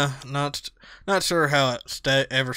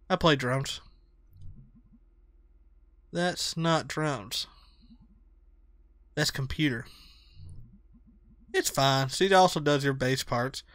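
lower, soft dry deadpan; deadpan Bea; 4 semitones lower, 7 dB quieter than usual. Acted. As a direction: say it bored and dry.

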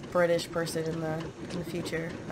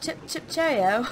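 hammed-up, higher-pitched British cheerio; cartoonish mock-British, pitched up; attempted British accent; 5 semitones higher than usual. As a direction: have a silly try at a British accent. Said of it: "what do British people sound like?".

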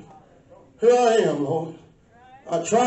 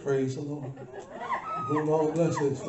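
mock half-hearted praise; 8 semitones lower, 10 dB quieter than usual. Mocks timid churchgoer praise.

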